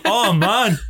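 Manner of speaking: high, hammed-up "oh man"; mock dismay. Quoting a mate reacting to the film, mock groan. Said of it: "He going".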